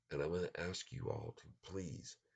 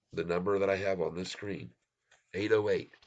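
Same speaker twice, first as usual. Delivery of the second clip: loud; 9 dB louder than usual. Real, not acted. Stressing target price.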